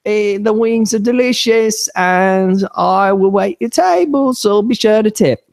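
high, slow chavvy plug; mock-plug it as a chavvy Mary Poppins; Paige. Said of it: "she also does the whole".

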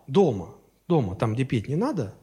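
normal talking voice

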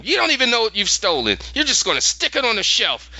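high, rushed retold tirade; mild mock yell. Lyra accusing the collector, mock yelling. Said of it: "she starts yelling at him".

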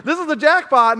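a triumphant cry of jackpot; excited jackpot. Retold or imitated. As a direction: crow it like you struck gold. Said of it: "I'm sorry".

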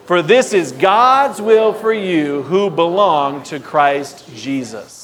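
slow, emphatic scripture reading; read loudly and clearly. Real, not acted. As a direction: proclaim the verse slowly to the church. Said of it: "Let's read loudly and clearly".

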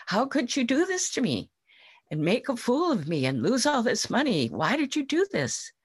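high, pained reproach, rising fast; angry and hurt. Acted. Farmer scolding his ox after losing the bet, angry and sad. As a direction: pour out betrayal and rage in a rush. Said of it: "so angry and so sad".

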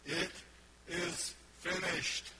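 mild cry of completion; declared cry. Retold or imitated. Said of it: "he cried out".

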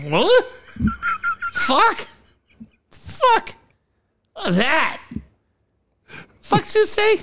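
high, squeaky mock horror at himself; yelping in hammed-up disbelief; mock shock. Retelling his own horror at high pulp juice, a squeaky mock shriek.

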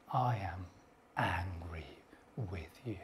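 very low, hushed, slow near-whisper; anger held down to a barely audible low murmur; calm anger. Retold or imitated. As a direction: imitate someone icily calm while angry. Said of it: "You can do it just as calmly".

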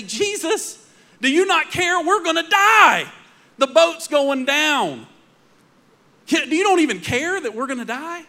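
terrified disciples shouting to a sleeping Jesus; panicked cry. Acted. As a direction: cry out like men sure the boat is sinking.

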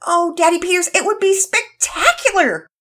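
put-on high, loud girl's cry of delight; girl shouting with delight to her father; near shout. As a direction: practically shout it with joy. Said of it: "Penelope practically shouted".

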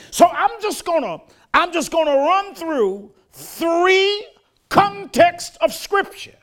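rising, measured pulpit cadence with punched words; full preaching. Acted. Pastor preaching the gospel to his congregation, fervent. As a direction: preach it, drawing out the big phrases.

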